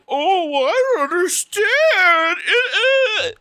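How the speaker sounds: high, drawn-out mock wailing; whiny Netanyahu. Speaker mocks Netanyahu's government as baffled, in a high mock whine. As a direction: cry it out in mock bewilderment. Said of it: "they're gonna be like".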